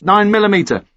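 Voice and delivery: usual speaking voice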